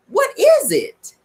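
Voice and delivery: slow, high "What is it?"; drawn-out question. Real, not acted. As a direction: stretch the question out in mock wonder.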